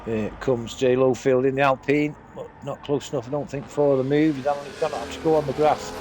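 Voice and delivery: ordinary talk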